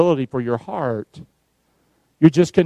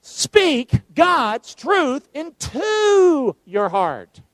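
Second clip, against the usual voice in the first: slow call-and-response; 7 semitones higher, much slower than usual. Real, not acted. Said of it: "say it with me".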